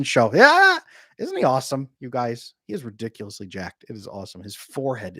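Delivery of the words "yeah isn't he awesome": high, loud burst of delight; a real excited yell; excited cheer. Real, not acted. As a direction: cheer it out for a friend. Cheering about the Chris Williamson show.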